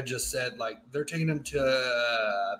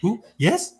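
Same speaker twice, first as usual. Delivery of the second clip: high, eager mock excitement; overjoyed at the news, higher and louder; perked up excitement; 4 semitones higher, 7 dB louder than usual. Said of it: "Yeah, he perks up".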